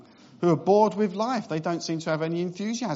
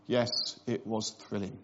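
flat, lower, softer mock-bored reply; bored honeymooner; 7 semitones lower, 6 dB quieter than usual. Imitating a bored newlywed answering a question about the honeymoon. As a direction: newlyweds playing down a trip that thrilled nobody. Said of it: "where they're just bored already".

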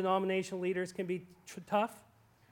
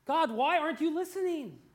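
anguished cry; 9 semitones higher, 4 dB louder than usual. Retold or imitated. Anguished plea voiced.

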